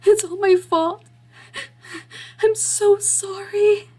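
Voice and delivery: high, slow tearful voice; guilty girl weeping; crying apology. Acted. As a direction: sob out the apology. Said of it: "Izzy crying".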